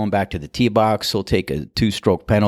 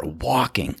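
normal talking voice

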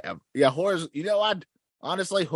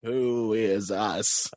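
very slow, drawn-out questions; mock bewilderment; much slower than usual. Time travelers after the jump, hammed-up slow bewilderment. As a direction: stretch each word in hammed-up confusion.

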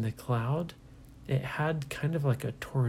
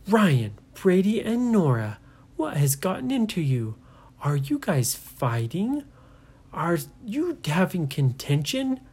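higher, louder scolding parent; stern parent; 5 semitones higher, 3 dB louder than usual. Acted. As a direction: call the kids to account.

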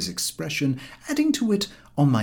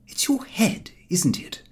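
kindly; 10 semitones higher than usual. A narrator's reading. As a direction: murmur it gently. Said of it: "said Harris kindly".

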